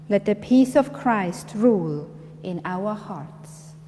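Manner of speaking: ordinary talk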